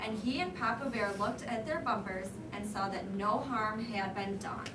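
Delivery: ordinary talk, nothing marked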